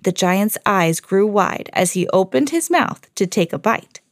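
plain reading voice

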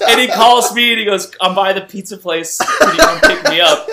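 high, loud, laughing through the punchline; laughing retelling. Real, not acted. Friend at the climax of his story, laughing hard. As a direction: crack up while telling it.

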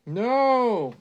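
high, loud, drawn-out "no"; shouted cry. A narrator's reading. Albert wakes up, with a loud high cry.

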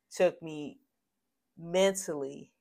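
usual speaking voice

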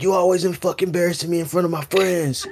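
high-pitched mocking imitation of a fed-up boyfriend; angry boyfriend. Neighbor's boyfriend berating her in front of friends, mock-angry. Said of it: "her boyfriend was like".

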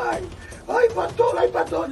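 high-pitched acted wail; mock tearful cry to the pastor; imitated crying. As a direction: whimper it like a man in tears.

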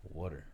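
low, soft, casual "Water"; "Water" with the t softened, tossed off; natural water. Shown as a demonstration.

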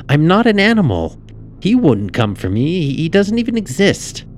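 put-on high, loud child's protest; protesting girl. A narrator's reading. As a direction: argue back in a high-pitched girl's voice. Frightened girl protests sister's threat.

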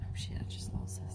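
hushed whisper under breath; quick panicked whisper; whispered curse. Real, not acted. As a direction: mutter it to yourself, barely voiced.

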